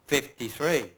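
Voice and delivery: ordinary talk, nothing marked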